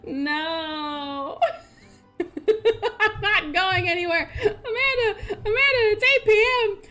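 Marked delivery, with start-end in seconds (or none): 2.2-6.8 high-pitched, teary laughing plea; can barely get the words out for laughing; real tears-of-laughter voice; crying with laughter. Real, not acted. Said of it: "I'm crying".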